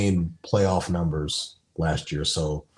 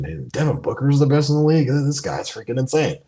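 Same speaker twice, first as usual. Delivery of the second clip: higher, fast, mock-yelled proclamation; half-shouted, pitched-up rave; mock rooftop scream; 7 semitones higher, 3 dB louder, faster than usual. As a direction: imitate someone raving from the rooftops. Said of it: "he's screaming at the rooftops saying".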